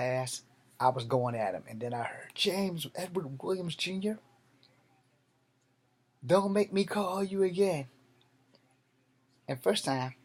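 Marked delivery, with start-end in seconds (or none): 2.3-7.9 dad calling out. Retold or imitated. The father calls his son in from across the yard. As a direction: call the boy in like an old school dad.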